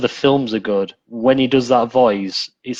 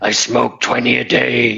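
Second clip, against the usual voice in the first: daft gruff voice. Retold or imitated. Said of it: "putting on some daft gruff".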